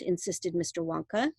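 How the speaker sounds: ordinary delivery, nothing marked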